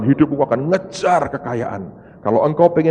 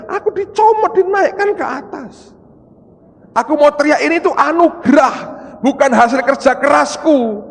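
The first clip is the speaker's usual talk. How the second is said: insistent retold cry; 7 dB louder than usual. Preacher insisting it is grace.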